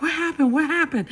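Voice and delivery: high, urgent imitation of a husband; worried. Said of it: "he is genuinely worried".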